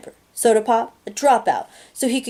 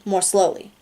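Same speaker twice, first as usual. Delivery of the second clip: ordinary delivery, nothing marked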